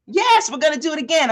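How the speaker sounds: bright, no-arguments encouragement; cheerful insistence. Retold or imitated. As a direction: coach cheerfully insisting on another run. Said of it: "coach insisting to client".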